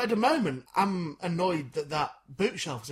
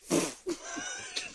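high cowboy yelp; 5 dB quieter than usual. Acted. Cowboy voice ends with whoop.

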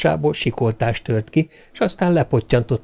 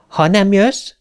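higher voice, rising at the end; sulky; 9 semitones higher, 4 dB louder, slower than usual. A narrator's reading. Little girl drawing away from the robot, lightly sulky. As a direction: pull away with a mild sulk. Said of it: "mondta elhúzódva".

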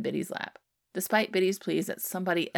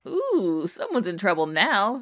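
cackling tease; 3 semitones higher, 8 dB louder, slower than usual. Acted. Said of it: "Shitty cackles".

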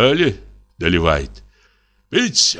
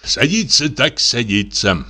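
normal talking voice

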